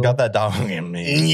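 slow, playful growly echo; playful dog growl. Pretend. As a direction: drag it out like a dog. Jokey growled echo of the phrase.

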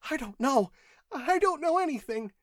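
high, panicky clerk; growing hysterical. A narrator's reading. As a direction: deny it in near hysteria. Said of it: "Primero was growing hysterical".

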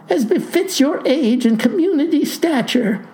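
high-pitched, flustered insistence; panicked. A narrator's reading. Clerk protests farmer's hair suits his age, panicked. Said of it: "says the panicked postal clerk".

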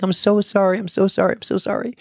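high, pleading repeated apologies; apologetic frightened woman. Retold or imitated. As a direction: imitate the nervous driver begging forgiveness. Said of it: "She's like".

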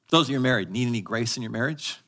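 normal talking voice

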